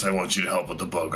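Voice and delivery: normal talking voice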